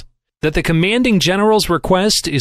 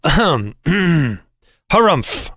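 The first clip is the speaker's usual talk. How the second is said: put-on an old man's rumbling ahem and harumph; pompous harrumph; throat clearing. A narrator's reading. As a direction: clear the throat pointedly before the favor. Cappy clears throat mid-speech.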